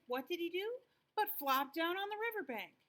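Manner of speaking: ordinary delivery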